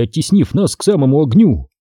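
plain reading voice

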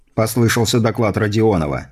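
ordinary delivery, nothing marked

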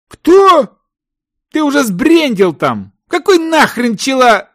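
very high-pitched disbelieving cry; incredulous outburst. A narrator's reading. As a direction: refuse to believe what you heard. Partner cannot believe the news. (недоверчивый возглас на высокой ноте)

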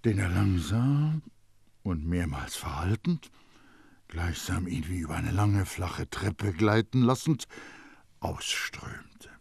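storyteller gliding down to a breathy end. A narrator's reading. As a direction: read it like one long breath let out.

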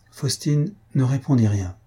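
plain reading voice

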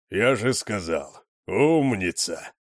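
slow, drawn-out praise; pleased praise. A narrator's reading. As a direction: draw it out as if praising a dog. Мол хвалит усмирённую собаку. (протяжно, с похвалой)

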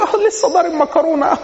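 a hint of an amused relative; high mock mother. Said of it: "كل يقول له".

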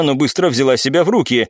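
ordinary delivery

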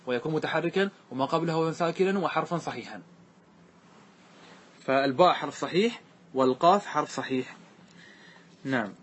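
ordinary talk

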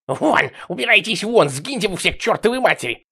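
high, loud shout to drive off visions; frantic hissing outburst. A narrator's reading. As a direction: scream them away, voice jumping up. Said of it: "захрипела, зашипела и начала плеваться". (яростный крик)

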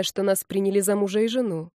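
plain reading voice

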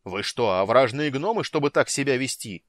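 loud furious rebuke; angry outburst. A narrator's reading. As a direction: explode at the crowd. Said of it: "взорвался Парсалиан".

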